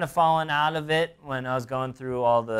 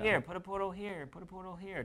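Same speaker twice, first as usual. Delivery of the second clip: higher, soft mock directing voice; imitated player instructions; 8 semitones higher, 6 dB quieter than usual. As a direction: lightly mimic a player pointing out spots, pitched up. Mimics players directing with ping tool.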